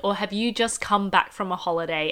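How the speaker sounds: ordinary delivery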